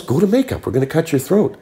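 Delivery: insistent repeat. Retold or imitated. Director repeating himself to a startled actor, high insistent delivery. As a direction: repeat the order emphatically, voice pitched right up.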